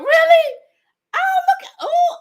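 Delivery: squealed high, loud surprise; thrilled squeal at a viewer's French Creole aunt; delighted surprise. Real, not acted. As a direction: shriek with delight at the news.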